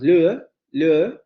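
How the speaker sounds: "le" held as one long vowel, not a diphthong; long vowel demo. Shown as a demonstration. Teacher showing a diphthong heard as a long vowel, careful. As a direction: stretch the vowel in "le". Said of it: "parece más bien una vocal larga".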